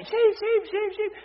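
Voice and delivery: high, loud repeated calling; shepherd calling. Shown as a demonstration. Shepherd searching the hills, calling his lost sheep high and loud. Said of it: "he's calling the sheep".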